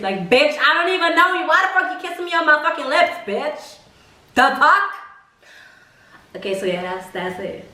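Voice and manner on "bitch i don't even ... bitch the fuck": high, fast outraged rant; indignant high-pitched retort. Retold or imitated. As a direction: fire it back at him, pitch up in disbelief. Outraged retort to unwanted kiss.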